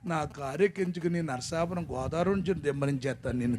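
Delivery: usual speaking voice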